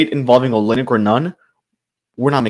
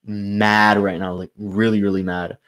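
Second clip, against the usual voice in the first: slow, fading into softer; slowed emphasis; 4 semitones lower, 4 dB quieter than usual. Real, not acted. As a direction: drag out mad, then trail off softer.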